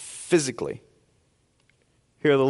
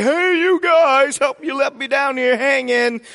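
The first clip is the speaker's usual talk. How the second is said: a trapped giant bellowing for help; Sloth from The Goonies; 8 semitones higher, 4 dB louder than usual. Retold or imitated.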